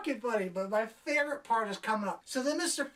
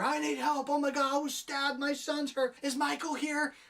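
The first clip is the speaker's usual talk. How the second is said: higher, frantic mock cries for help; panicked patients; 6 semitones higher than usual. Frightened townsfolk crowding the hospital, mock panic. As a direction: imitate a hospital full of panic. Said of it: "people screaming and yelling".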